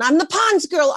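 "Pond's" lifted high, bragging to family on the phone; a touch of giddy boasting; proud announcement. Retold or imitated. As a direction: tell everyone back home with pride. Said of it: "I called everybody in Portland".

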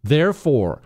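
normal talking voice

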